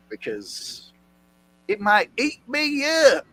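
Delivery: high, hammed-up Southern imitation; mock Southern accent. Mimicking the woman's fear, accent.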